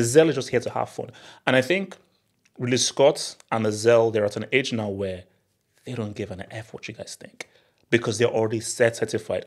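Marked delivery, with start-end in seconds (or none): none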